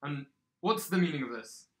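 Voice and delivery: loud guard demanding an answer; suspicious guard on duty; challenging guard. Acted. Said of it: "hold them and say".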